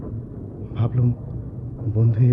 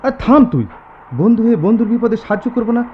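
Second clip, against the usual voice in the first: loud, higher scolding; heated rebuke; 11 semitones higher, 7 dB louder than usual. Acted. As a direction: scold him, you'd help a friend. Rebuking hesitant friend in car. (উত্তেজিত ধমক)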